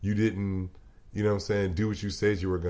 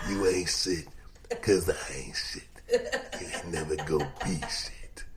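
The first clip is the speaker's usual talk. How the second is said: mock speech; 5 semitones lower, 4 dB quieter than usual. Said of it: "greatest five heartbeat speech I ever heard".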